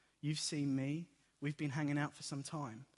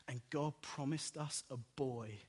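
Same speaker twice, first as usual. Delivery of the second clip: normal talking voice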